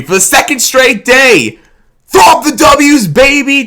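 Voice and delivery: high, loud celebratory shout; hyped shout. Real, not acted. Fan vlogger celebrating Tigers win, high loud shout. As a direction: throw it up like a fan at the game.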